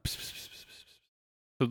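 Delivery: unvoiced tiny hissing murmur; whispered murmuring. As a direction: whisper pss like distant tiny voices. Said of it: "hear them going like".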